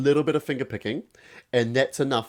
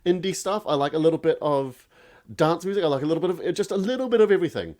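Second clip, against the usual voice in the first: high, fast, airy mock-naive voice; imitating her younger self; 6 semitones higher than usual. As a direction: breeze through it like a clueless fan. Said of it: "I'd just be like".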